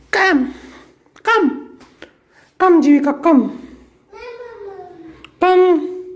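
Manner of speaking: high sing-song come calls; coaxing pet call. Real, not acted. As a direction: coax the pet over in a high voice. Calling the pet over.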